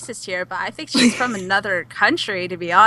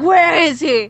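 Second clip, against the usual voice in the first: loud, raised-pitch mock demand; mock demanding yell; 6 semitones higher, 7 dB louder, much slower than usual. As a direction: call out like a fan on the hunt. Acting out fans storming in.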